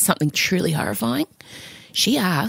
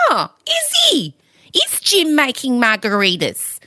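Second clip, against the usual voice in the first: higher, slow, wounded mock sarcasm; 6 semitones higher, slower than usual. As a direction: play the hurt mother, drawn out. Jealous mock reply to daughter.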